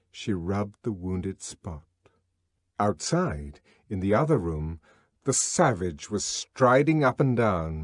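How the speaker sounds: usual speaking voice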